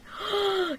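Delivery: shocked gasp. Acted.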